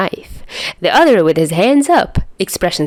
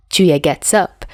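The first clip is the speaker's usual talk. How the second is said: plain reading voice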